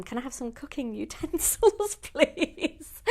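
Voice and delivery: laughing through a cheeky request. Real, not acted. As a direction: giggle out the ask. Laughing while asking a cheeky request.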